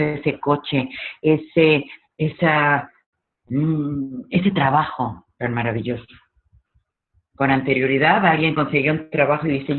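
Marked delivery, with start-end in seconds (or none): none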